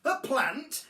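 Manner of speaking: high, loud disbelieving echo; horrified yell. A narrator's reading. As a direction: yell it back in horror. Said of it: "yelled Mum in horror".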